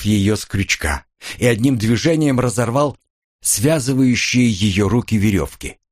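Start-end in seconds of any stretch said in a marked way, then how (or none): none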